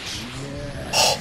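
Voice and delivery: sharp breath in at the bad play; a quiet startled gasp; shocked gasp. Real, not acted. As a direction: gasp of dismay. Dismayed gasp at a loss.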